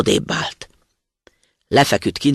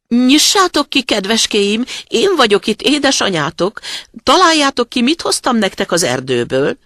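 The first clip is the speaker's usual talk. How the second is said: high, loud, coaxing mother-goat voice; wolf posing as mother; 9 semitones higher, 5 dB louder than usual. A narrator's reading. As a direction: call out to the kids in a put-on high voice. (magas, hangos, hívogató kecskemama-hang)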